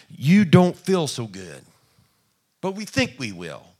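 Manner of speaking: ordinary talk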